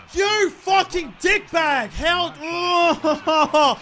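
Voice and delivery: slow, drawn-out angry yell at the screen; real frustration bursting out; furious shout. Real, not acted. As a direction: curse the opponent out loud. Angry at intercepting opponent.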